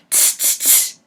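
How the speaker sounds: whispered tss tss tss; soft cheeky tsk; monkey tsking. Acted. Monkeys mock the peddler.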